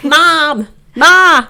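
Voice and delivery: high, loud calling for mom; a child calling out for mom. Retold or imitated. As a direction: yell for mom like a toddler.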